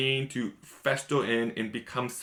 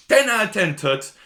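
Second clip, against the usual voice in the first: loud, higher gleeful shout; cheeky cheer; 9 semitones higher, 7 dB louder than usual. Retold or imitated. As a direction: crow it out with glee. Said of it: "she's like".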